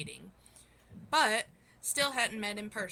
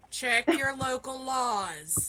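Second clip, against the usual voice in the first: imitating Aunt Shay; 4 semitones higher, 6 dB louder, much slower than usual. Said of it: "Aunt Shay is saying".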